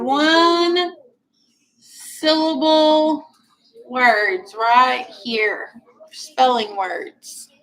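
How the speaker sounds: slow, careful teacher dictation. Shown as a demonstration. Teacher dictating spelling words to the class, slow. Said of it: "dictating to class".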